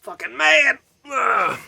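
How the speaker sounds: high, loud mock growl; mock mad voice. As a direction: ham up looking furious. Mocking his red-lit face.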